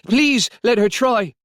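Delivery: high, loud, drawn-out appeal; pleading. A narrator's reading. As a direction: beg slowly and urgently.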